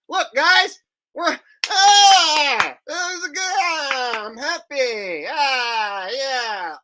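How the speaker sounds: high, tearful, hammed-up joy; fake-cry with happiness over the job; mock happy sobbing. Mocking a tearful astronaut's joy.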